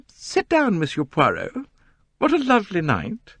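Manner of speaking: high complaining novelist voice; high gushing society lady. A narrator's reading. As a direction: coo over the night like a grand dame. Mrs Otterbourne hails Poirot.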